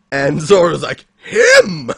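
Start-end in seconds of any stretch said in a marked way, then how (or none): 1.2-1.9 loud, high-pitched exclamation; acted shock. Recapping Zoro's reaction to the unmasked face, loud mock shock. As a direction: blurt it out as a shocked character. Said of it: "this look of absolute shock".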